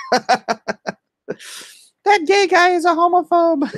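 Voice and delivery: high squeaky mocking whine; shrill little heckler voice, shouted. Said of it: "squeaky little voice in the corner".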